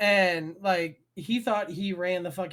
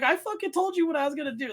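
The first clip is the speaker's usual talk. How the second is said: higher, fast heated retort; retold angry retort; 8 semitones higher, faster than usual. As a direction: snap it back. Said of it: "I'm like".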